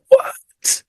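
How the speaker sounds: breathy, voiceless mock "what?"; incredulous, nearly voiceless outburst; stunned disbelief. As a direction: re-enact being dumbfounded. Retelling his stunned reaction to casting.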